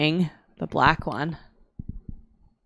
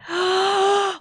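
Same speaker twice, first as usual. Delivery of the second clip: sharp high gasp of disgust; a sudden horrified intake of breath; shocked gasp. Real, not acted. Shocked by gross infection story.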